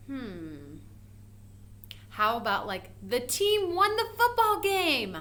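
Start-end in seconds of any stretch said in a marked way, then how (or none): 3.0-5.2 raised, slow excited sentence; excited example. Shown as a demonstration. Teacher to class, reading the game win excitedly. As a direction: read it like big news. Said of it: "a sentence that would use an exclamation point".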